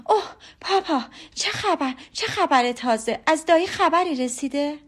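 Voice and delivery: breathless high-pitched excitement; eager cry. A narrator's reading. Elizabeth asking papa for news from uncle, eagerly crying out. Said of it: "با شوق و ذوق فریاد زد".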